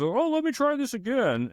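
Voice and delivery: high, chirpy mock assistant voice. Coder mimicking the AI retrying, in a high mock chirp. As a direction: play the overeager AI, way up high. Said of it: "then says".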